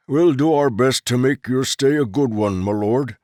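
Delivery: a lightly voiced old foreman, eager to please; humble foreman. Foreman apologising to the noble, lightly voiced deferential tone.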